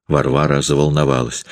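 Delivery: plain reading voice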